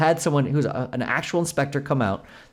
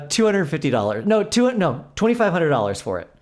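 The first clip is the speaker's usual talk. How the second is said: ordinary talk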